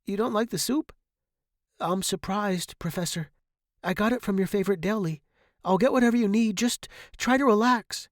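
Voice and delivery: high, hurried fussing; anxious placating. A narrator's reading. Student to professor rejecting soup, anxious.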